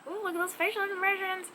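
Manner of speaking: bright excited remark; delighted exclaim. Real, not acted. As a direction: gush with delight.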